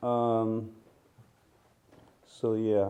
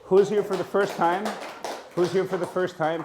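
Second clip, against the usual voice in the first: raised voice calling to the room; 9 semitones higher, 6 dB louder than usual. Real, not acted. Teacher calling out to the room, loud and high. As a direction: call out to the whole class, loud and high.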